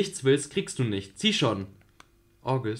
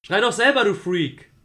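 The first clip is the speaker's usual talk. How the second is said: loud, much higher retort; angry shout; 9 semitones higher, 9 dB louder than usual. Acted. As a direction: yell it back at him. Julie snaps back at him. (wütend zurückschreien)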